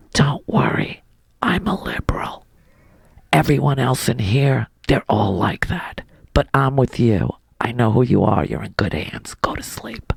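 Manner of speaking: low, hushed, secretive reassurance; conspiratorial whisper. Retold or imitated. Anesthesiologist secretly reassuring the patient, whispering. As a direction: whisper it like a secret ally leaning in. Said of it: "she whispers to me".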